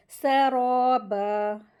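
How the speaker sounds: सराबा loud, with rounded, stretched raw; heavy ra. Shown as a demonstration. Teacher models heavy ra, सराबा with long rounded raw. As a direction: thicken and hold the raa of सराबा. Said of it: "तो रा को heavy करेंगे".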